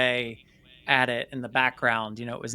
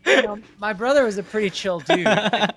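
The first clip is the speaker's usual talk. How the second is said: laughing; 8 semitones higher, 6 dB louder than usual. Real, not acted. Laughing after retelling brother's joke.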